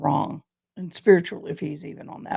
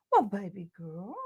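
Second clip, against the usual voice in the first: soft cooing to the puppy; gentle pet voice; dog talk; 8 dB quieter than usual. Real, not acted. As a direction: coo softly at the dog. Talking to her dog.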